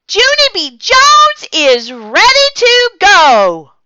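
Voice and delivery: very high, loud, slow excited shout; singsong gleeful shout; excited yell. A narrator's reading. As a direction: announce it with glee. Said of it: "I yelled".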